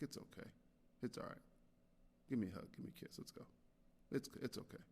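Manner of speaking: gently hushed comfort, fading to a whisper; soft reassuring hush. Retold or imitated. Dad comforting his daughter over the erased recording, hushed and gentle. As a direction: soothe a little girl softly, almost whispering.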